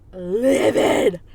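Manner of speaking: "livid" drawn out long and loud; heavy emphasis. Real, not acted. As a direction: lean hard and slowly on "livid". Teller dramatizes his fury.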